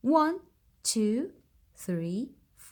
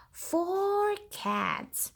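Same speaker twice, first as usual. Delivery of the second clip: gasped, higher announcement; mock surprise; 7 semitones higher than usual. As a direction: surprise the kids with the find. Gasp then announcement.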